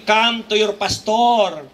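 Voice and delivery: slow drawn-out come to your pastor; slow teasing twist of the verse; mock correction. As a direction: stretch it out, teasing.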